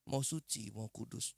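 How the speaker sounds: ordinary talk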